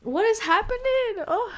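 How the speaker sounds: high-pitched bewilderment; bewildered cry. Real, not acted. Viewer lost at the episode's turn, high bewildered cry.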